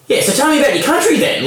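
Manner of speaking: high, quick question in an imitated Aussie bloke's voice; old Aussie bloke. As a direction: mimic the old bloke turning curious, pitched right up. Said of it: "real Aussie bloke".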